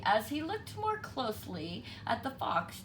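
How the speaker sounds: plain reading voice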